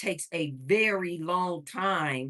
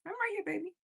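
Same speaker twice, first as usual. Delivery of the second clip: cooing to the dog; 14 semitones higher, 7 dB quieter, faster than usual. Real, not acted. Owner reassuring her dog she is nearby, high soft coo.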